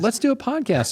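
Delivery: high, eager mock voice of a newbie; excited naive voice. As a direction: play it wide-eyed and giddy. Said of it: "it was just like a".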